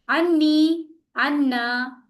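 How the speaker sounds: अन्नी and अन्ना with the नून held long; doubled n with shadda. Shown as a demonstration. Teacher showing students the shadda, doubled n held. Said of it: "मिन्नी और मिन्ना में नून पे शद आया था ना".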